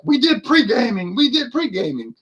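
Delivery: high-pitched mock group chorus; everybody chiming in. Panelists announcing their pre-gaming, mock chorus. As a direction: imitate tipsy panelists piping up high. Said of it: "And then everybody's like".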